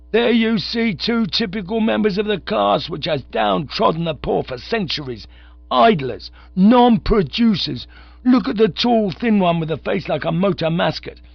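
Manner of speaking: loud, high soapbox harangue; ranting orator. A narrator's reading. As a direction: denounce them to the crowd. Soapbox orator denouncing the rich.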